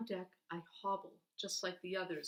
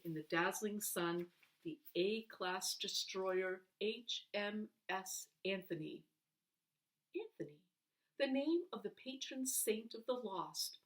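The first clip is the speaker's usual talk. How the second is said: ordinary delivery, nothing marked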